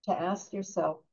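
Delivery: unhurried meditation guide with long pauses; guided meditation. Acted. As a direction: gently lead them into stillness, leaving long silences. Leads a meditation.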